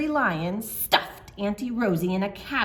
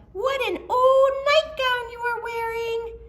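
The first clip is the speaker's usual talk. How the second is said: put-on little girl voice; 6 dB louder than usual. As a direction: coo it like a cheeky child.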